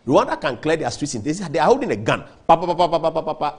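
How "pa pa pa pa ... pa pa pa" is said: loud staccato pa pa pa gunshots; mock gunfire bursts, ending in a laugh; imitated gunfire. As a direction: rattle off the shots like a machine gun. Gunmen clearing streets by shooting.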